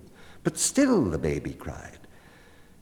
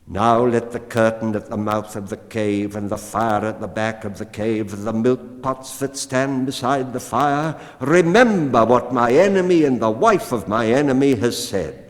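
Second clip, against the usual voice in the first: louder, rising into a triumphant vow; the cat claims her bargain, building louder; proud cat vow; 4 semitones higher, 8 dB louder than usual. A narrator's reading. Cat arching her back at the woman, growing loud and proud. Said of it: "the cat arched her back and said".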